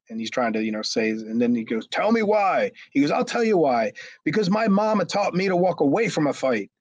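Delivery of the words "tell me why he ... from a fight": high-pitched mock indignant protest; imitated defiant outburst. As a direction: mimic an upset man justifying himself. Retold defiant explanation by Chad.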